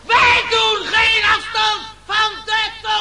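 loud, defiant declaration; defiant shout. Acted. The speaker defying his rivals for the tongue, shouting. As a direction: shout it as the final blow.

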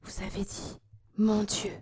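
muted, horrified exclamation; hushed dread rising on the last words; terror-struck. A narrator's reading. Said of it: "avec un indicible accent de terreur". (terreur contenue, à mi-voix)

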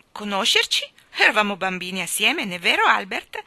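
loud, lively exclamation; loud, chummy reminder; cried out. A narrator's reading. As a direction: exclaim it to an old childhood friend. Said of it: "gridò la signora Curry".

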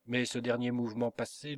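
ordinary delivery, nothing marked

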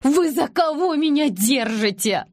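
high, drawn-out indignant question; outraged cry. A narrator's reading. Nazeli confronts the women, high and outraged. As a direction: fling the question at them. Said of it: "выкрикнула она, задыхаясь".

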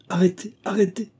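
high, thin exclaimed protest; crying out. A narrator's reading. Moïse interrupts the squire's torrent, crying out. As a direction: cry out to halt the argument. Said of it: "s'écria l'autre".

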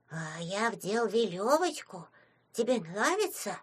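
put-on soft, slow childlike voice; shy little imp. A narrator's reading. Small imp asking if Anya likes it, shy and soft. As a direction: offer it softly and slowly, like a tiny child.

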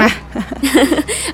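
a short soft giggle at herself; light laugh. Acted. Ana laughing at herself with her friend, soft giggle. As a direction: laugh off your own big wish.

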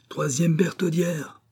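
high, drawn-out call; crying out. A narrator's reading. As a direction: call it out like a demand across a courtyard. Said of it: "s'élança vers une tour en criant".

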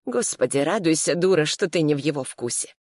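ordinary delivery, nothing marked

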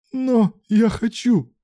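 very high, drawn-out protest; pleading exclamation. A narrator's reading. Young man protesting to his lover, crying out high. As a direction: cry it out high, insisting. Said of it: "воскликнул парень".

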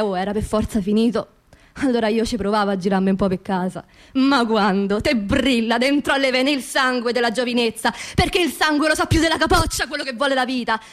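usual speaking voice

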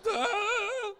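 high, loud burst of pretend crying; mock wail. Dad moved by daughter's prayer, mock high wail. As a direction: wail as if suddenly overcome. I'm just like.

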